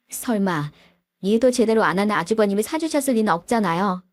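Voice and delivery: ordinary delivery, nothing marked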